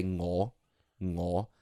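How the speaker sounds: ngo with a nasal ng at the start; demo of a Cantonese sound. Shown as a demonstration. Showing a friend the Cantonese syllable ngo. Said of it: "that sound".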